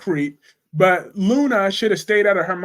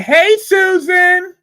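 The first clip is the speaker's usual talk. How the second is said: high, loud, happy call-out; excited greeting; 12 semitones higher, 9 dB louder, much slower than usual. Real, not acted. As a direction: shout hello to a friend in chat. Greeting a viewer by name.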